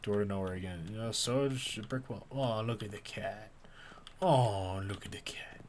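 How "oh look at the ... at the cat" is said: whispered delight at a cat. Real, not acted. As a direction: hush it, don't scare the cat.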